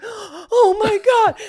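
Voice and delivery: very high, slow, drawn-out wail; slow panicked whimper. Retold or imitated. Caught by the cop, high mock panic. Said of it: "And I was like".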